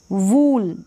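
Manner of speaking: "वूल" with drawn-out oo; wrong long oo. Shown as a demonstration. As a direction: stretch the vowel in "वूल". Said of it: "वूल नहीं पढ़ेंगे".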